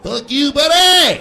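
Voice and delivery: put-on loud, high parting jab at a kid; mock angry shout. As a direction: yell it gleefully hammed-up, punching the end. Parent cursing at kid, acted.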